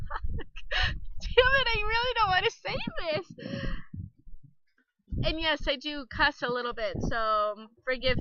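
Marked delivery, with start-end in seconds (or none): 0.1-3.5 high-pitched mock-tearful whine; whiny reluctance. Embarrassed woman dreading saying it again, whiny mock reluctance. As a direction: protest in a high, pleading, hammed-up whine. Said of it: "of course, I'm like".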